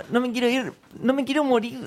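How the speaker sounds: high but soft begging; imitating Peter's plea. Podcaster retelling Peter's plea, soft high imitation. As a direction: mimic the scared kid, quietly. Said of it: "y Peter diciendo".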